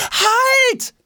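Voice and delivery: very high urgent yell; shouting. A narrator's reading. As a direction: cry out to stop someone. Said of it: "schreit Jonas". (laut aufschreiend, hoch)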